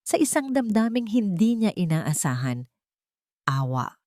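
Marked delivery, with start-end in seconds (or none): none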